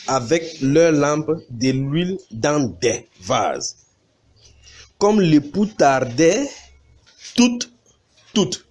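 slow, deliberate reading with stretched words; emphatic scripture reading. Acted. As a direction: proclaim the Bible verse phrase by phrase. Reads scripture aloud to congregation. (lecture biblique solennelle et appuyée)

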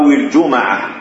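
الجمعة as "al-juma'a", a after the m; fatha on mim. Shown as a demonstration. Teacher giving the correct forms, الجمعة with fatha on mim. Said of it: "إسكان الميم وفتحها".

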